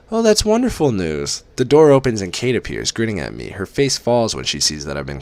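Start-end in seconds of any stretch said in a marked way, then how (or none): 0.1-1.4 much higher, beaming mother; mother thrilled by job interviews; delighted mother. Acted. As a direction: gush over the good news.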